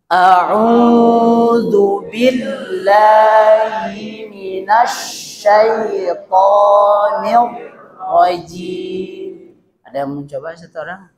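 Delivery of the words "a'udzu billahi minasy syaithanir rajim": chanted Quran recitation drawn out; slow recitation. Shown as a demonstration. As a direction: recite the ta'awwudh slowly in tajwid style. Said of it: "Kita ulang bacaan".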